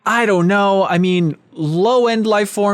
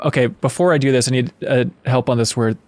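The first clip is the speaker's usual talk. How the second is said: ordinary delivery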